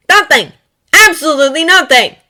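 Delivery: high, loud, emphatic declaration; emphatic exclaiming. A narrator's reading. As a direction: proclaim it loudly.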